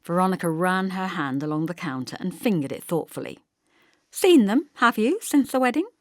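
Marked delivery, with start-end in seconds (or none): 4.1-5.9 high, loud prying village gossip; nosy neighbour. A narrator's reading. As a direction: fish for news with sugary brightness.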